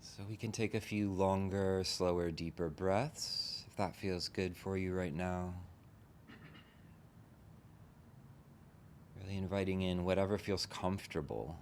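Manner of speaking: unhurried meditation guide, long pauses between phrases; guided meditation. Real, not acted. Teacher leading a group into relaxing the body, guided meditation. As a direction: gently talk them into settling, leaving space after each phrase.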